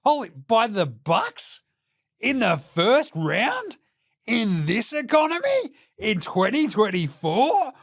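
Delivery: like a whole nation in shock at the pick; mock disbelief. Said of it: "Everybody in Australia's like".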